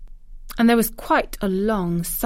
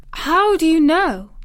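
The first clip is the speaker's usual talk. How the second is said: unsympathetic; 9 semitones higher, 6 dB louder than usual. A narrator's reading. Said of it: "said Mary unsympathetically".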